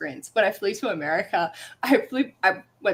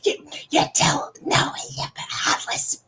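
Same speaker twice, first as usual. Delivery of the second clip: unvoiced, slow conspiratorial whisper; whispered aside; 5 semitones higher, slower than usual. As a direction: hiss it under your breath. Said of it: "I'm like".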